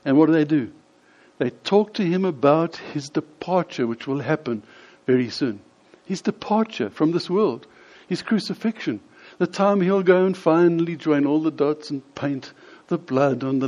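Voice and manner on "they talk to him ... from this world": normal talking voice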